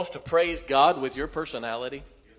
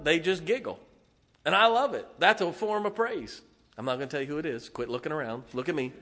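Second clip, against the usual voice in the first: ordinary delivery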